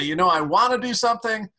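high-pitched imitation of a stalling customer; hesitant customer. Coach imitating customers who freeze, high hesitant voice. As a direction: play a customer putting you off, pitched well up. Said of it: "Maybe you've had customers say".

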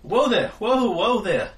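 high, loud whoa to a beast; loud calming call. Acted. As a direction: call out to steady a charging animal. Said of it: "he says".

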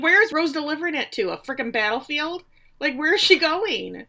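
high, loud incredulous questioning; baffled. Retold or imitated. Podcaster wondering where Rose takes the letter, baffled. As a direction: exclaim in baffled disbelief, pitched well up.

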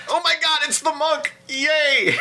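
very high, delighted squeal; gleeful fangirl squeal. Retold or imitated. Fan imitating her own delight at the monk, high squeal. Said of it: "it's like".

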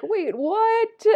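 high, startled double take; surprised. Retold or imitated. Two online friends realizing they've met, stunned. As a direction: pop it up in stunned disbelief. The amazing moment of recognition.

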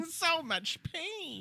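high, slow mock anguish; angsty wail. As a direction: moan it like a tortured rocker. Mocking an angsty singer.

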